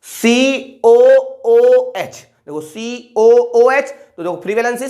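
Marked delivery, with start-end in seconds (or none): 0.1-2.2 each letter of सीओओओएच stretched very slowly; drawn-out dictation. Shown as a demonstration. Teacher dictating the formula to students, very slowly drawn out. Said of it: "teacher drawing out formula letters". 2.5-3.9 drawn-out dictation. Shown as a demonstration. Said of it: "teacher drawing out formula letters".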